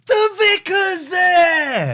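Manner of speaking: slow, loud shout. Real, not acted. Friend shouting at the TV about the vicar, slow loud shout.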